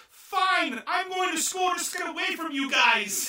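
high, loud tearful shout; crying princess. Retold or imitated. Princess crying at butlers chasing with her tooth. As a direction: wail it like a sobbing spoiled girl. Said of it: "And she starts crying".

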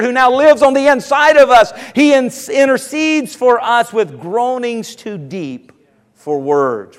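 rising preacher's cadence, building in force; preaching. Acted. Pastor to his congregation, preaching with rising fervor. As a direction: preach it out to the pews.

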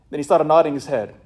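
ordinary talk

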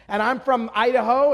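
normal talking voice

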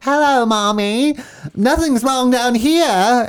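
put-on sing-song it slowly like a haunted child; creepy possessed girl. Possessed daughter answering her mom, slow eerie high voice.

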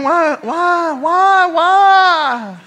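very high, hammed-up lament; mock despairing wail. Father exposed by his daughter, mock despairing wail. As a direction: wail each word in mock agony.

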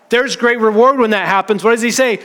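ordinary talk, nothing marked

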